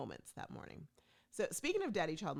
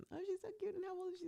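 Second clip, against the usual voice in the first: gushing; 5 dB quieter, much faster than usual. Retold or imitated. Imitating gushing TSA agents.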